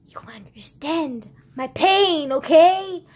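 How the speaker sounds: loud, slow, pleading insistence; emphatic plea. Real, not acted. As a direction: make them feel your pain.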